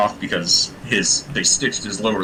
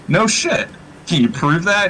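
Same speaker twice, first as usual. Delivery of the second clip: incredulous; 7 semitones higher than usual. Retold or imitated. Soldiers react in disbelief.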